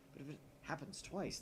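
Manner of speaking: ordinary talk, nothing marked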